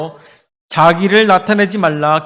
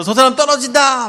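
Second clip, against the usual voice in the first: high, raised cry of a shocked onlooker; crowd's alarmed shout; 6 semitones higher, 4 dB louder than usual. Acted. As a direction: act out a crowd crying out. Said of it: "많은 사람들이 막 소리를 지르겠죠".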